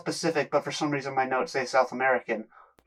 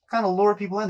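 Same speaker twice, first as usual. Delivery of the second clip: normal talking voice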